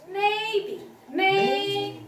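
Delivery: "Maybe" held high and level; first tone. Shown as a demonstration. Teacher modelling first tone, high and level. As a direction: keep "May" on one high, even pitch. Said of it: "that's first tone".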